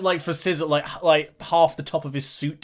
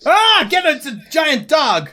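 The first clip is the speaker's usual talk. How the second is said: loud, high alarmed yell; panicked cry; 13 semitones higher, 8 dB louder than usual. Acted. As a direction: cry out as if a huge beast is on you. Startled cry at giant dog.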